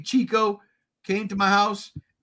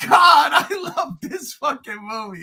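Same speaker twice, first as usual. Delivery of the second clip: higher, louder gushing cry; loud adoring shout; 8 semitones higher, 6 dB louder than usual. Real, not acted. Host gushes about Final Wars, loud and elated. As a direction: shout your love for it. Cheering over favorite movie.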